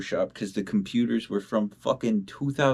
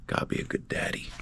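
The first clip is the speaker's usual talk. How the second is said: whisper to himself; 5 dB quieter, faster than usual. Real, not acted. Talking to cat wanting out.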